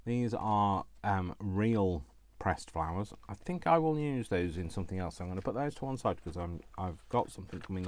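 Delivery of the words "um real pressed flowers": usual speaking voice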